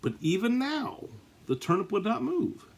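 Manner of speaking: squeaky high disbelief on but still; dramatic high exclaim. A narrator's reading. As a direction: leap way up in mock astonishment. Suspense beat in read-aloud story.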